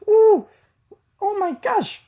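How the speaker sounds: high squeal of fake surprise; mock shock. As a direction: squeal like it's scandalous.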